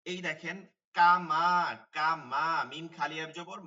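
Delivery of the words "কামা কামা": loud demo. Shown as a demonstration. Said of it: "teacher shows example word".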